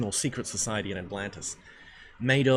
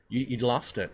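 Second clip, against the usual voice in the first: usual speaking voice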